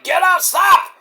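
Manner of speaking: high, frantic mock referee yelling stop; imitating referee. Referee telling the wrestler to stop, high and frantic. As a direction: play the panicked ref. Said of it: "The referee's like".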